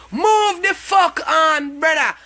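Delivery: drawn-out yelled dismissal, pitched high; slow angry shout. Real, not acted. Yelling at commenters who leave angry faces, slow furious shout. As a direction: shout it slowly, every word spat out at the troll.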